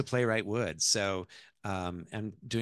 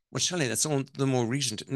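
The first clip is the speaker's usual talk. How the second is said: put-on quick, higher English-accented protest; 5 semitones higher, faster than usual. Wife objects to her mother-in-law, English accent. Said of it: "I'm gonna do the ... the accent".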